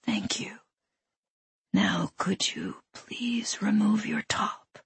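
slow, soft, polite whisper; whispering intruder. A narrator's reading. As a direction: whisper the request slowly, chillingly courteous. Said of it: "that loathsome whispering man".

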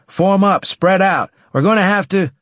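high, loud battle orders; shouted orders. Acted. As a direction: bark commands to the squad. Squad leader barking orders in battle.

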